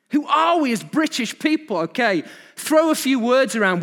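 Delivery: loud, high-pitched exasperated preaching; impassioned preaching. Real, not acted. Preaching at full force.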